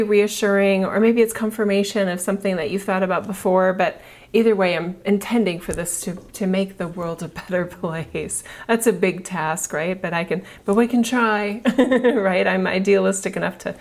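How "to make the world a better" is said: usual speaking voice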